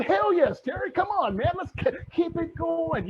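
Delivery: high, eager mock cheer from the crowd; excited cheer. Audience cheering back at Terry, high excited shout. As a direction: cheer him on like a fired-up fan. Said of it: "You guys go".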